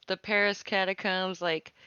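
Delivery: usual speaking voice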